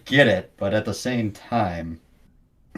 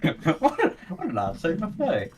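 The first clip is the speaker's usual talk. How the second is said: laughing; 7 semitones higher than usual. Real, not acted. Repeating the quote, laughing.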